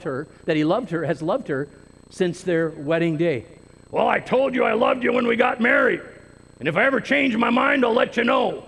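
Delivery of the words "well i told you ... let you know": a brisk, mildly raised mock husband, brushing her off; careless husband. Preacher mimics a careless husband answering his wife, lightly mocking and brisk. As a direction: toss it off like a husband who can't be bothered.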